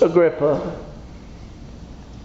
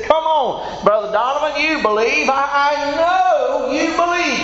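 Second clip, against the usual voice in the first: pleading appeal; 8 semitones higher than usual. Acted. Paul pleading Agrippa to believe.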